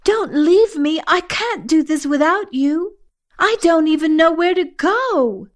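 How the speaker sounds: high, pleading, acted panic; frantic yell. A narrator's reading. You beg Asha not to leave, frantically. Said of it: "you yell frantically".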